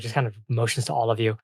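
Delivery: ordinary talk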